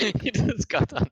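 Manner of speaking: words squeezed through laughter; high, giggling delivery; laughing. Real, not acted. Laughing at a subscriber name.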